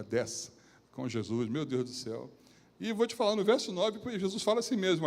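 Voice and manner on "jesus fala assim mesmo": usual speaking voice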